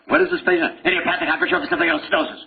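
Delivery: high, very fast medical jargon; mock rapid-fire. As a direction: reel the long term off in one comic rush. Acted exchange rattling off the term.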